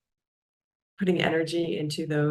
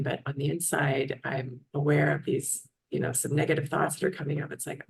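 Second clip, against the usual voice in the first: usual speaking voice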